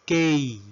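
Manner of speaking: letter K pronounced "kay"; English letter K. Shown as a demonstration. Teacher modelling the English alphabet, K as "kay". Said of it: "La letra K".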